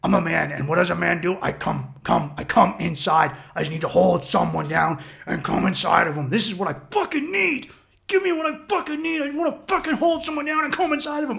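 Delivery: hammed-up inner beast; primal brain voice. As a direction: growl it as pure instinct. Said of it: "my primal brain was like".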